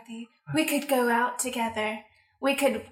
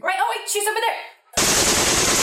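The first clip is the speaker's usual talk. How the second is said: excited spotting; 6 semitones higher, 4 dB louder, much slower than usual. Acted. Spotting target in the room.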